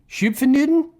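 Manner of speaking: Schupfennuden asked high and loud, unsure; unsure German pronunciation. Shown as a demonstration. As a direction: toss out Schupfennuden as a doubtful question. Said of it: "I'm trying my best".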